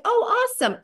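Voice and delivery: high-pitched cheery reply; light up with pleased surprise; delighted. Retold or imitated. Seller answering an interested prospect, high and delighted.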